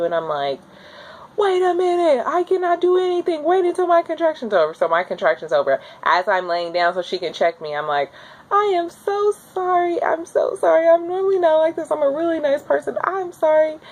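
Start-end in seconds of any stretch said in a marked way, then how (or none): 1.4-4.7 high, strained pleading cry; pained plea. Retold or imitated. In labor, pleading with the nurse to wait. 8.5-13.8 high pleading apology. Retold or imitated. After a contraction, apologizing to the nurse in a high plea.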